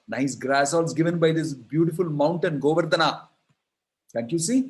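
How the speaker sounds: high, loud and insistent, pressing each question; rally them to the mountain with passion; fervent pleading. Acted. Krishna urging the cowherd men to honor Govardhana, loud and fervent. Krishna urges villagers to stop yajna.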